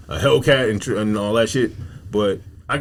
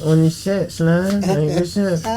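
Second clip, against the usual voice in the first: higher-pitched put-on gang member; 5 semitones higher, much slower than usual. Shown as a demonstration. Comic demonstrating his voice to listeners, gang member voice. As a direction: greet him as a hammed-up gang member. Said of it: "that's my gang member voice".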